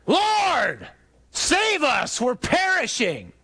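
high, drawn-out desperate shout; yelling over storm. Acted. Disciple calling to Jesus in the storm, yelling. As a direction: yell it to the back of a boat. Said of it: "one of us yells to the back of the boat".

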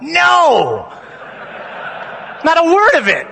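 mock crowd shout; yelled out. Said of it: "we all yelled out".

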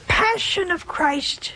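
slow prayer, fading to a whisper; slow reverent recitation sinking into a whisper; prayerful. Shown as a demonstration. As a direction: pray it slowly, ending in a whisper.

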